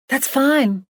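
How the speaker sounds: high-pitched call across a distance; calling back. A narrator's reading. Emily answers him from afar, calling back. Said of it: "Emily called back and waved".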